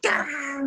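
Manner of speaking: held-back high scream; mock angry scream. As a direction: imitate a wild attacking shriek. Imitating angry woman lunging with knife.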